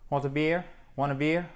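"Want a beer" run together quickly; fast casual contraction. Shown as a demonstration. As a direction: drop the "do you" and rush it. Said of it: "on va plus vite et on dégrade".

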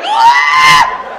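high, loud shriek; panicked scream. Retold or imitated. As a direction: scream like a scared man fleeing. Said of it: "He was like".

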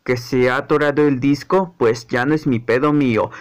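deep, low character voice; dismissive figure. Acted. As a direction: shrug off the problem in a low voice. Low-voiced figure refuses to help.

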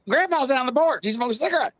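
high-pitched, urgent report; retold urgent alarm. Grandchild warns just-woken grandmother, retold urgent alarm.

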